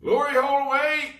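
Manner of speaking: high, loud pirate call. Acted. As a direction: shout it up the crater. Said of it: "he called up to Captain Claw".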